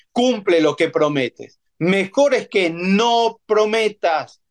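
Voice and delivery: slow, solemn reading of scripture; slow, solemn Bible reading. Acted. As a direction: read each verse slowly, weighing it. Reads Ecclesiastes aloud slowly.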